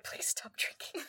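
slow pleading whisper; whispered plea. Retold or imitated. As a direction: beg her in a whisper, slowly. Jackie pleads with drunk bride.

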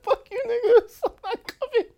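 very high, loud, laughing yell; gleeful shout. Pretend. Imagining skipping class for the role, high gleeful yell. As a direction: shriek it like you just won.

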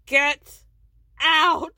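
mock yell. [name] ordering Meredith out, mock yelled. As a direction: belt it like a throwaway order.

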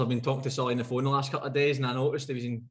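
ordinary delivery

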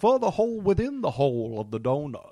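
hammed-up character impression with a put-on accent; Daniel Craig. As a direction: ham up the film detective's accent. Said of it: "You're quite good at it".

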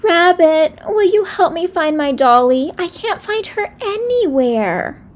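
put-on high, pleading little girl; a small child asking for help; little sister voice.

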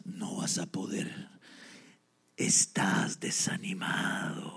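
low, slow, hushed tempting; soft, sinister slow whisper; demon whisper. Acted. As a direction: murmur doubt into the ear. Demon whispers doubt again.

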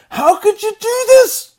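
very high, loud, slow shocked accusation; horrified cry. Acted. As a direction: scream it in disbelief. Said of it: "and they ask him".

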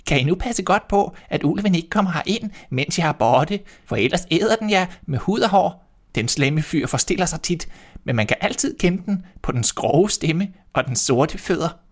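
high motherly goat voice. A narrator's reading. Mother goat warning her kids, high and caring. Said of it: "kaldte den på dem alle syv og sagde".

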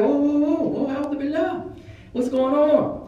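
high-pitched mock panic; high, startled whoas, acted out; retold bewildered alarm. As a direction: back away in bewildered alarm. Retold backing away, bewildered.

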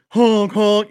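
high, loud honking; imitated horn honk. Impatient rink guy on the Zamboni, mimicked honking.

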